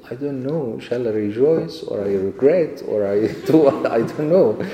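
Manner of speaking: laughing confusion. Retold or imitated. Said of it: "Said to me".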